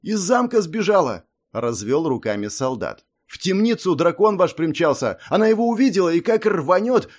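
high, loud panicked report; panicked soldier. Acted. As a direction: blurt it out in alarm.

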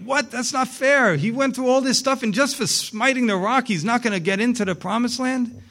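high-pitched protest at unfairness; indignant disbelief. Acted. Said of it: "Boy, you talk about unfair".

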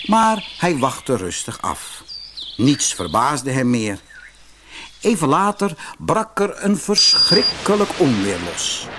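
plain reading voice

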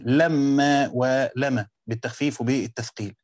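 normal talking voice

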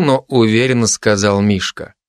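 ordinary delivery

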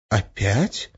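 soft rising question of surprise; surprised. A narrator's reading. Witch accused of breaking oaths, surprised. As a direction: query it, mildly taken aback. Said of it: "удивилась Арина".

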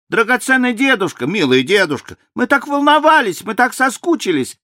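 очень высокий восторженный детский голос; пропищи как ребёнок; детский голосок. A narrator's reading. Дети обнимают деда. (восторженные детские голоса)

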